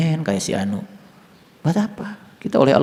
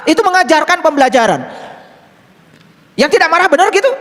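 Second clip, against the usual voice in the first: high, loud, ringing sermon voice; full-force preaching; 13 semitones higher, 3 dB louder than usual. Acted. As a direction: proclaim it loudly to the congregation.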